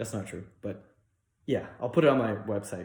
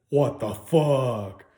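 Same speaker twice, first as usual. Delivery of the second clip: slow, louder mock astonishment; retold shock; 6 dB louder, much slower than usual. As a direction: stretch it out in stunned disbelief.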